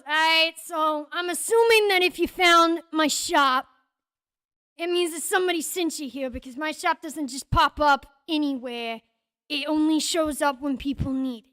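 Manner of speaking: loud, businesslike shopkeeper patter; brash shopkeeper. Acted. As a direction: lay down the shop's rules loudly. Game master voices shopkeeper.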